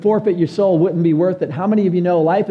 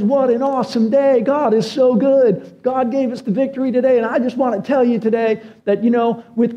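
higher, excited retelling of the coach's praise; joyful coach quote; 6 semitones higher than usual. Retold or imitated. Pastor imitating a Clemson coach thanking God after a win, higher and excited. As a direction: relive the coach's joy on live TV. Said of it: "He goes".

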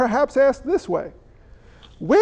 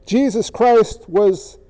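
earnest pastoral prayer; closing prayer; 3 semitones lower than usual. Acted. Pastor leading the congregation in prayer, sincere.

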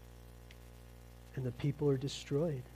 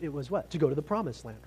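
ordinary delivery

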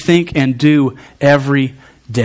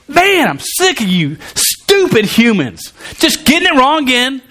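exasperated anger; 9 semitones higher than usual. Acted. Preacher voicing God as he once imagined, high exasperated anger. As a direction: rail in hammed-up disgust, pitched high. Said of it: "anger, I've always sort of thought that".

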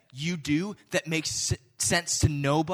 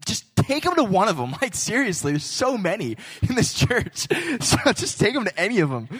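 laughing; 6 semitones higher, 6 dB louder than usual. Real, not acted. Joking about church mechanics.

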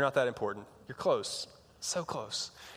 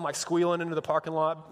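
usual speaking voice

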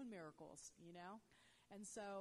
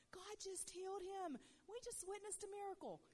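retold scream; 12 semitones higher, 4 dB louder than usual. Speaker telling the crowd of a healing, retold excited scream. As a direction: re-enact screaming for joy, pitched way up. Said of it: "I was screaming".

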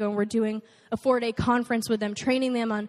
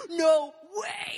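pumped; 5 semitones higher than usual. Retold or imitated. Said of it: "so pumped".

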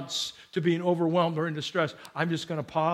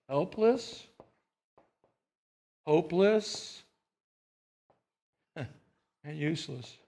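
softly repeating the list, much quieter; quiet echo; 2 semitones lower, 6 dB quieter, slower than usual. Shown as a demonstration. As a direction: let the words fade to a hush. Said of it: "here's what I'd like to say".

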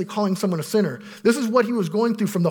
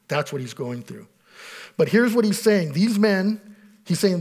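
ordinary talk, nothing marked

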